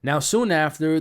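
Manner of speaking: ordinary talk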